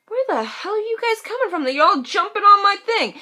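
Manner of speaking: high-pitched mock exasperation; incredulous demand. Acted demand to feed visitors.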